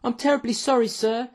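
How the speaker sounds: put-on high-pitched, apologetic young waiter. A narrator's reading. Waiter from the kitchen to his boss, apologetic. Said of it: "said a young waiter".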